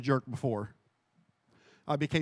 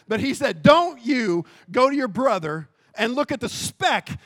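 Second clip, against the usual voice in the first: forceful preaching; 6 semitones higher, 10 dB louder than usual. Acted. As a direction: build to a loud, high rebuke.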